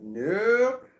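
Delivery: emphatic nope. Real, not acted. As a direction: snap the twist with a high nope. Story twist punchline.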